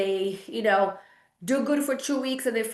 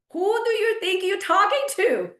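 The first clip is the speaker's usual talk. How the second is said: higher and pointed, offended; indignant; 9 semitones higher than usual. Real, not acted. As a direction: call out the rudeness, offended. First indignant take.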